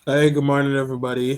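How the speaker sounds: normal talking voice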